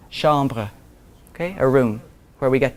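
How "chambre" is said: "Chambre" with nasal French am, shahm-bruh. Shown as a demonstration. Teacher to class, modelling French "Chambre". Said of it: "the word chambre".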